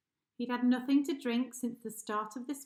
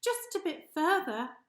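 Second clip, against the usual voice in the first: brave hippo; 6 semitones higher, 5 dB louder than usual. Acted. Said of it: "he bravely said".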